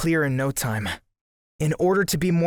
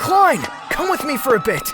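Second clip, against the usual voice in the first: loud high urgent call; 8 dB louder than usual. Acted. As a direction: call him over urgently.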